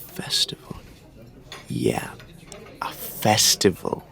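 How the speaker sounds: soft, half-whispered realisation; hushed wonder. Acted. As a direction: let the word out slowly under the breath. Savouring the found word.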